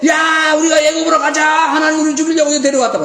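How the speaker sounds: high, loud mob shout; crowd crying out. Retold or imitated. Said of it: "다 부르짖고".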